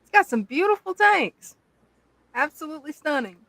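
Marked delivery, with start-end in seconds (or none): none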